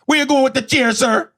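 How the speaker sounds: very high, loud Jamaican questioning; Jamaican woman. Retold or imitated. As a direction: interrogate him in a Jamaican accent. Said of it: "is a six-foot-two Jamaican woman".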